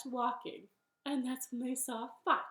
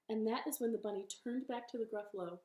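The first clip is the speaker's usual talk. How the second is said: usual speaking voice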